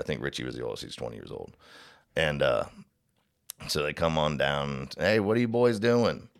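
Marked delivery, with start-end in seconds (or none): 5.0-6.3 imitated cop, pitched up high; officer hailing. Park officer coming upon boys drinking, hailing them. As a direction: hail them like a patrolling officer.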